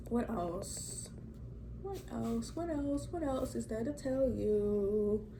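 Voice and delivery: soft, absent-minded murmur to oneself; thinking aloud. Real, not acted. Vlogger hunting for what to tell viewers, thinking aloud.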